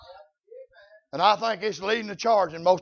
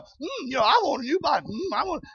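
soft, lazy mock moaning; a lightly voiced idle grumbler; preacher mocking a halfhearted groaner; mock whiny complainer; 5 semitones higher, 4 dB quieter than usual.

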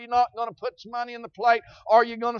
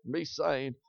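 much louder, higher preaching voice; fiery pulpit preaching, voice raised high; preaching at full force; 7 semitones higher, 11 dB louder than usual. Acted. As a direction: lift it up and preach hard to the congregation. Preacher's raised sermon climax.

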